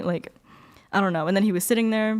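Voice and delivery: ordinary talk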